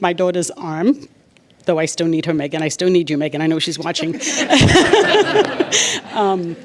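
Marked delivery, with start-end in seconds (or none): 4.2-6.1 high, loud burst of laughter; real delighted laugh. Real, not acted. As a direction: laugh out loud, pitched well up. Laughs after addressing watching Megan.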